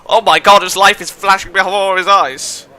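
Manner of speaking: big hammed-up exclamation; mock alarm. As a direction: cry it out in over-the-top alarm. Player exclaims over the scene.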